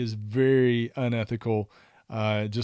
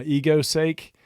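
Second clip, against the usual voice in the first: normal talking voice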